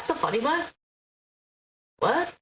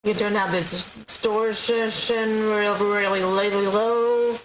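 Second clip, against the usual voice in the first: distorted voice; 5 semitones lower, 5 dB louder than usual. Shown as a demonstration. Playing with voice distortion.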